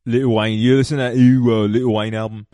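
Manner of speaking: bad, hammed-up British accent; terrible mock-British accent. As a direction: put on a silly British voice. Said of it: "like, a British person".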